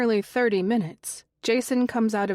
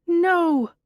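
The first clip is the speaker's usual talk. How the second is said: sudden high scream in the dark; screaming shout; 9 semitones higher, 6 dB louder than usual. A narrator's reading. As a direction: cry out loud in terror. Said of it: "a shout in the darkness screams".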